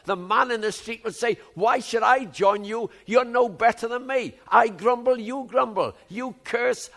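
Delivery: ringing indignant challenge from the pulpit; loud exasperated outburst. Retold or imitated. Preacher voicing frustrated outsiders, loud indignant challenge. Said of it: "And I think".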